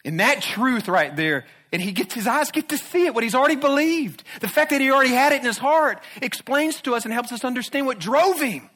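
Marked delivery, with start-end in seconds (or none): 1.7-8.7 high, ringing preacher's lift; raised pulpit fervour; preaching at full force. Acted. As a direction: soar up on the vision of Christ. Preacher lifts voice on vision.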